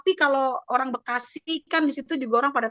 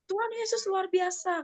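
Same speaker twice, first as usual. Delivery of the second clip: retold exclamation; 8 semitones higher, 4 dB quieter than usual. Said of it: "kata gitu".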